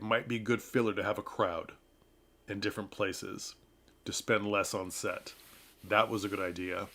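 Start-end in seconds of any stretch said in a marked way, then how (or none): none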